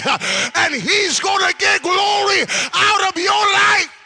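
high, loud, gasping sermon cadence; full-force preaching. Real, not acted. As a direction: shout it out in driving, punched phrases.

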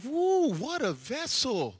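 slow, drawn-out mock wow; mock admiration. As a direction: imitate an awestruck admirer. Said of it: "no one's coming out going".

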